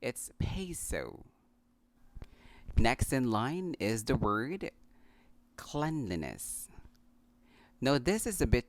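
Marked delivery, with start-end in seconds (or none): 0.4-1.2 "peso" as PAY-soh, drawn out; slow "peso" with both diphthongs emphasized; correct pronunciation. Shown as a demonstration. 5.6-6.7 "cleanliness" as KLEN-lee-ness; short e opening "cleanliness": KLEN; correct pronunciation. Shown as a demonstration. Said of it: "the first syllable is pronounced clen".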